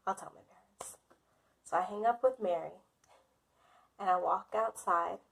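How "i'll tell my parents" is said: barely voiced, giving in; defeated whisper. Agreeing to friend Mary's demand, whispered in defeat. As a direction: whisper it like a reluctant surrender.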